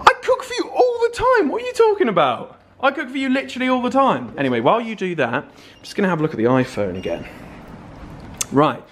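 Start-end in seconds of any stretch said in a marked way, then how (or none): 0.1-4.3 higher, fast mock-outraged protest; indignant protest. Real, not acted. Older brother protesting to James about cooking, indignant. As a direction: protest that the claim is absurd.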